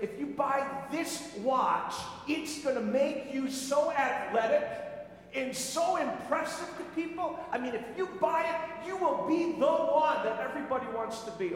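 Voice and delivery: mock watch commercial; ad pitch. Mimicking watch ads.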